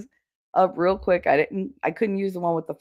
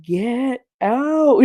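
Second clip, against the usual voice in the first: higher, drawn-out mock order to leave; retold yell; 7 semitones higher than usual. Son sending grandmother out of the room, mock yell. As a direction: imitate an exasperated yell, stretched out. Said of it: "He's yelling at my mother".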